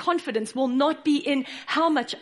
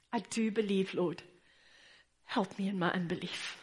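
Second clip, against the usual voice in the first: soft prayer; 3 semitones lower, 7 dB quieter than usual. Retold or imitated. Preacher's own prayer, softly.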